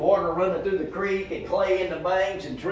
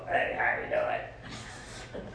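much lower, very slow, breathy croak; low slow smoker croak; 8 dB quieter, much slower than usual. Retold or imitated. Preacher imitates the smoker woman's greeting, slow deep croak. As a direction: drag it out like wrecked vocal cords. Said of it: "He talked like that".

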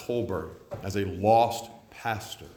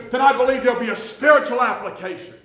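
much higher and louder, a pushy eager voice; urging young preacher; 11 dB louder than usual. Retold or imitated. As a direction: mimic the cocky young preacher pressing hard. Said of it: "he's just urging me on and on".

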